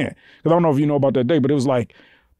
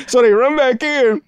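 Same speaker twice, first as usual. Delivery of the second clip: ordinary talk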